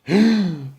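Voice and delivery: a high sharp gasp from the crowd; audience gasp. Acted. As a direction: gasp in shock. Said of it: "The audience gasped".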